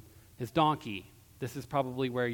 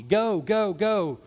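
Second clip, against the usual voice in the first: loud, high repeated urging; urging shout; 5 semitones higher, 5 dB louder, much slower than usual. Acted. Balaam beats his stopped donkey, shouting it onward. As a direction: drive a stubborn animal on.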